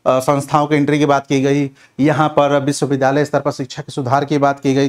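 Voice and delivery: usual speaking voice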